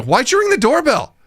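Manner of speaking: high, retold exasperated demand; aggressive reaction. Dad questioning daughter about the doorbell, exasperated. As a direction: imitate an incredulous, fed-up parent. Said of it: "I have an aggressive reaction to that".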